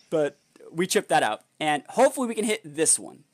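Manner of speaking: ordinary talk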